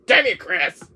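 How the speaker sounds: Wesker voice. Acted. Wesker cursing his enemy Chris, loud and high. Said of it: "You have, like, that Wesker voice".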